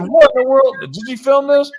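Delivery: high, loud retold disbelief; astonished. Patient asking laughing doctors about a video, astonished. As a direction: pitch jumps up in mock shock.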